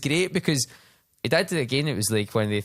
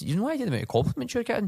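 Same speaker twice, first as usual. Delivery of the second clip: encouraging remark; 11 semitones higher, faster than usual. Retold or imitated. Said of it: "I says".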